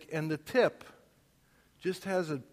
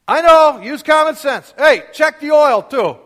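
put-on much higher and loud, slower on the last line, cheeky teenage shout; imitating a yell; 10 semitones higher, 18 dB louder than usual. Teenager yelling both reminders at his dad, imitated yell. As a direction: holler both reminders back at once. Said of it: "sometimes I'd be like".

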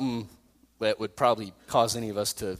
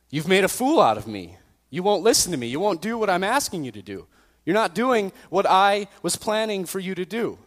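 acted exasperated rant, raised pitch; angry scolding; 7 semitones higher, 3 dB louder than usual. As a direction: berate the donkey, pushing louder and higher. Voices Balaam arguing with donkey.

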